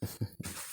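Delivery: brief, very soft villain snicker; hushed evil chuckle; short breath of dark amusement; sinister chuckle. Acted.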